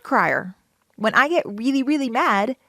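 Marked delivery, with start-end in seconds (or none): none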